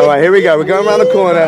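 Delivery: tense, loud, high yelps before the drop; nervous shouting. Real, not acted. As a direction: brace yourself and yell. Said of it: "You can hear me screaming for my life".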